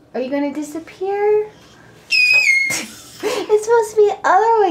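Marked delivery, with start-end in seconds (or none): 2.1-2.8 very high short squeal; playful shriek. Pretend. Mother screaming in peekaboo with her child, playful shriek. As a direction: squeal in pretend surprise. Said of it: "You scream when you move it".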